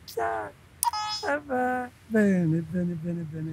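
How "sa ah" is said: high, thin mock beep in a put-on voice; a playful beep imitation; imitated detector beep. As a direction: mimic the detector's tone. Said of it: "si sente sta voce".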